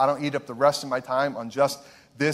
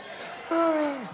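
laughing; 11 semitones higher, 5 dB quieter than usual. Real, not acted. Laughs at own joke.

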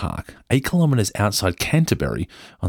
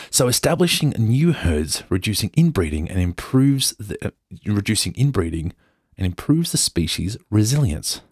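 put-on posh English accent; 3 semitones higher than usual. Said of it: "go full Downton Abbey".

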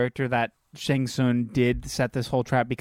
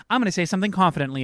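mock confident Johnny; 6 semitones higher, 3 dB louder, much faster than usual. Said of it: "he's like".

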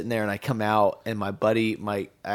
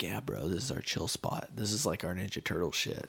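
hushed; 4 semitones lower, 10 dB quieter than usual. Retold or imitated. Guys showing candlelit spot.

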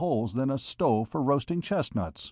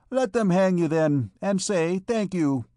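higher, dismissive comeback; scornful retort; 6 semitones higher, 3 dB louder than usual. A narrator's reading. Vagabond wench turning her back on the penniless man, retorting. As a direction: throw it back at him with scorn. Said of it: "retorted the vagabond wench".

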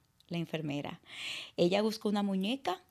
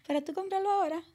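much higher, amused pharmacist disbelief; incredulous laugh; 10 semitones higher, faster than usual. Retold or imitated. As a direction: laugh at the very idea of buying it. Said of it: "y él se ríe así". (el farmacéutico, incrédulo y riéndose, mucho más agudo)

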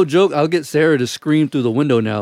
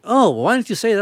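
mock surprise; 9 semitones higher than usual. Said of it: "I'm like".

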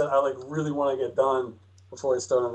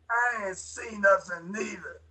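Vortigaunt voice; 5 semitones higher, 4 dB quieter, slower than usual. Acted. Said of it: "I'll do the Vortigaunt voice".

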